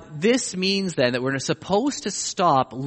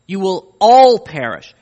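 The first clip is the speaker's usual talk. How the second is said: louder, slow, stress on all; emphatic repeat; 8 dB louder than usual. Shown as a demonstration. Preacher pressing the warning on his congregation, emphatic. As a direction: hammer every word home. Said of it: "also repeated twice".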